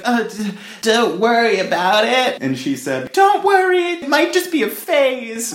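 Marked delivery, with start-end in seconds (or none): 0.0-2.4 nervous younger self. Retold or imitated. Acts younger self to mom. 3.1-5.5 mocking mom voice. Voices mom's reply.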